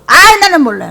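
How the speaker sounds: high, loud acted disavowal; loudly wash your hands of it; exaggerated protest of ignorance; mock denial. Acts churchgoer denying the pastor.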